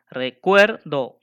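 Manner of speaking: Recuerdo very slow, CUER loud and held; stress on CUER. Shown as a demonstration. Teacher showing the rhyme starts at E in Recuerdo, loud long CUER. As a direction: punch and stretch CUER in Recuerdo, do apart. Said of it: "de la E por allá".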